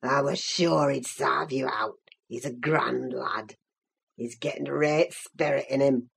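put-on very low, soft, gloating old servant; malignant old Joseph. A narrator's reading. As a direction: croak it low and mocking. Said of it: "a malignant, crackly laugh".

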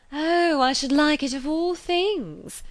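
high, slow, eager exclamation; delighted gush. A narrator's reading. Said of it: "was not plainer in words than manner".

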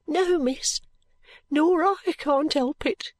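put-on very high child's voice; small maid admitting her own tears; tearful little girl. A narrator's reading. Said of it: "don't cry, if you please".

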